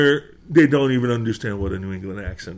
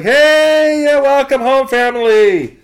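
higher, louder cheerful welcome home; excited welcome; 13 semitones higher, 7 dB louder, much slower than usual. Retold or imitated. New Hampshire locals welcoming the kid home, cheerful shout. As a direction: greet him like long-lost family. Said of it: "Everyone's like".

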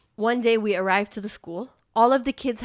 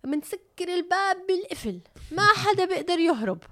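higher and quicker, falsely soothing; school lady reassuring; 9 semitones higher, faster than usual. Retold or imitated. School lady telling the mom the kids get locked in, higher and fast. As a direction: rattle it off like it's perfectly normal.

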